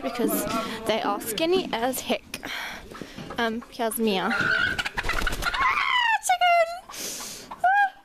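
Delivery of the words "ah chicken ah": high, shrieking fright cry at a chicken; startled shriek. Real, not acted. Startled by a chicken running past her. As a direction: yelp in real fright. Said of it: "I don't like chickens".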